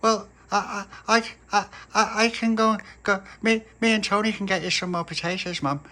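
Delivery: put-on a nervous kid tripping over his words; stammering little boy. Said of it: "little pappy said".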